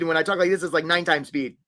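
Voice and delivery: normal talking voice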